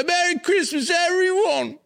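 very high, hammed-up English urchin; mock British accent. Imagining the UK collector out at Christmas, high mock English accent. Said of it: "Like Darth Mark would be out".

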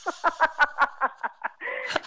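a high burst of laughter; real high laughter at the memory. Real, not acted.

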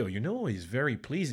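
put-on English with his own French accent; careful French-accented English; French speaker's English accent. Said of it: "l'accent parfait de [name]".

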